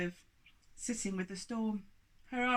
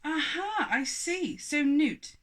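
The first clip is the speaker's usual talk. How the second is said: accusing giant; 8 semitones higher, 7 dB louder, slower than usual. Acted.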